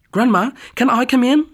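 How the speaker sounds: high bright call through the door. Acted. Girl at the door calling to her grandma, high call.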